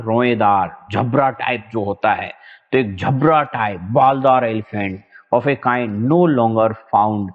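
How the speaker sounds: usual speaking voice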